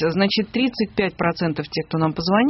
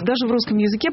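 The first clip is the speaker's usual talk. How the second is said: ordinary talk, nothing marked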